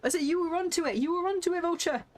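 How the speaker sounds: high, loud gleeful vindication; excited. Real, not acted. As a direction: crow that you called it. Excited she guessed the plot.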